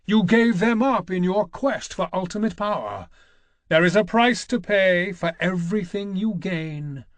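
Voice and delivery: a lightly voiced war god passing judgement; voice of Ares. Ares speaking through temple flames to Kratos, accusing. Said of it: "spoke to him in the voice of Ares".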